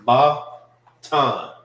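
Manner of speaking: Batan in two firm pieces, BAH... TAHN; syllable-by-syllable pronunciation. Shown as a demonstration. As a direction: sound out Batan with a clear gap between syllables. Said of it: "it's pronounced together".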